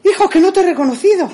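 high, loud and fast; excited exclamation. Retold or imitated. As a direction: gush it out to your son in disbelief. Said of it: "le digo".

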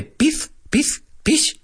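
high little-girl voice imitating daughter; squeaky toddler saying pez; child voice.

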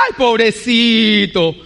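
drawn-out, coddling "poor thing"; mock pity. Preacher mimicking the sympathy listeners might want, mock pity. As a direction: pity someone with exaggerated slowness. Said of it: "¿Qué prefieres que te diga?".

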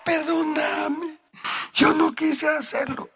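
high-pitched mock crying; imitated sobbing plea. Preacher as the pastor confessing to God, imitated crying. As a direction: wail it like a penitent, imitated. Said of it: "gritaba y lloraba".

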